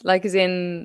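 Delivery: ordinary talk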